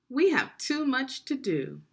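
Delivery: loud, high, impatient middle brother; busy middle brother. Acted. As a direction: brush him off, too busy to help. Middle brother brushes off again.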